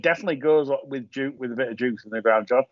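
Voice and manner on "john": ordinary talk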